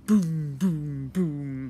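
loud booming knocks, fading; pounding sound. A narrator's reading. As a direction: pound out each boom. Pounding at the door.

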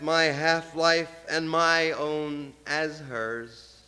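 normal talking voice

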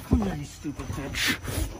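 low, slow snarl at the rival; menacing slow taunt; slow low menacing growl. Acted. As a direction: drag it out low and threatening. Wrestling promo confrontation.

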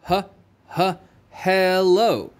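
slow "H, h, hello" with a breathy h sound stressed up front; slow breathy h, then "hello". Shown as a demonstration. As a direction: stretch the h sound at the start of "hello". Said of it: "We still make the h sound".

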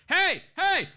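short, sharp warning calls; brief high-pitched angry hey; aggressive American anger. Retold or imitated. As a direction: challenge someone with a curt American shout. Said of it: "if an American person gets mad at you".

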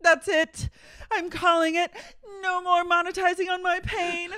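high, loud hammed-up crying; mock tearful quitting. Imagined star quitting in public, mock sobbing. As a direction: quit through fake sobs.

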